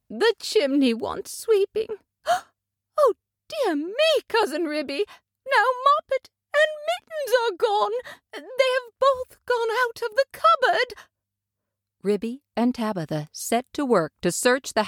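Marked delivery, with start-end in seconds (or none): 0.1-10.9 high, tearful cry with a gasp; a mother realizing both kittens are gone; panicking mother cat. A narrator's reading. Tabitha discovers kittens gone.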